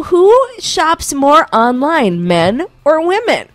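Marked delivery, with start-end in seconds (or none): none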